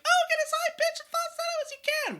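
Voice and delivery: mock the advice in a silly falsetto. Coach mocking common advice in a falsetto voice. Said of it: "People are like".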